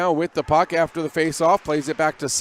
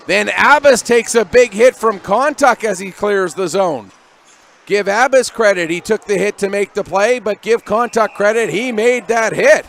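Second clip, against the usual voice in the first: loud, raised-pitch burst on the big hit; excited play-by-play; 4 semitones higher, 7 dB louder than usual. Real, not acted. Commentator to listeners as a player takes a big hit, excited. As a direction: punch it out over the action.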